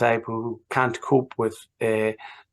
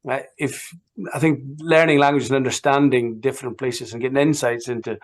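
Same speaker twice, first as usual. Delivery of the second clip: normal talking voice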